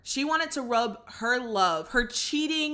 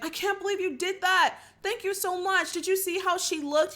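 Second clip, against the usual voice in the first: high-pitched mock gushing; imitated excited gratitude; 10 semitones higher than usual. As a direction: gush thanks like a thrilled friend. Acts Dom thanking Yaz excitedly.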